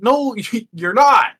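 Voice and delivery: high, loud, drawn-out contradiction; mock frustration. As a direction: argue back in comic frustration. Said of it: "I'm getting frustrated".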